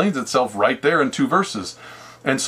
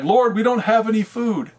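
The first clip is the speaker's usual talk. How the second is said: disciples worried; 7 semitones higher than usual. Retold or imitated.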